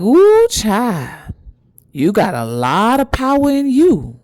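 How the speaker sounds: high, swooping hoodoo elder voice; drawn-out, marveling ancestor voice; mama spiritualist. Retold or imitated. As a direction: imitate an impressed little lady in the woods. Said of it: "She kinda talked like that".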